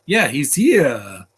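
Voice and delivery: slow, loud stretched cheer; drawn-out cheer. Real, not acted. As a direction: savour each word, slow and loud.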